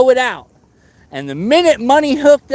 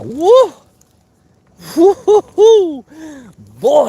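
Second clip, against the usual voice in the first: excited woo; 7 semitones higher, 4 dB louder, much slower than usual. Real, not acted. Hyped about commentating.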